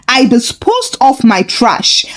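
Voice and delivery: plain reading voice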